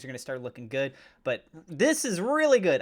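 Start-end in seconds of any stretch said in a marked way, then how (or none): 1.7-2.8 high, loud burst of delight; really happy. Real, not acted. Host delighted at the long-awaited drop, happy exclamation. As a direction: let the happiness leap out, loud. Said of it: "I'm so happy to finally see this".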